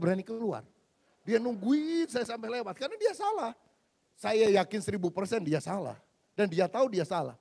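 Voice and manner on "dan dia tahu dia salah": usual speaking voice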